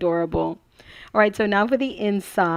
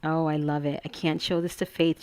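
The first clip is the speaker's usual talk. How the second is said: normal talking voice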